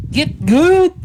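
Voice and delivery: Doctor Lulu voice. Retold or imitated. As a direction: bark it like a drill coach. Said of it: "Doctor Lulu's voice in your head".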